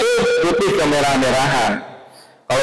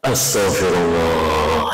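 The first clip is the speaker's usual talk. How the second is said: low, slow prayerful sigh of the words; slow astagfirullah; 8 semitones lower, much slower than usual. Shown as a demonstration. Preacher shows how to calm down, slow and low. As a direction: draw it out to calm yourself. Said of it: "cuman mampu menahan".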